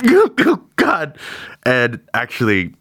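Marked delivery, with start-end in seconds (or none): 0.1-1.2 much higher, louder choked cry; overcome Oh God. Acted. Trevor sees the medal and chokes up, mock-weepy. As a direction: break on the words as if near tears. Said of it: "Trevor just goes".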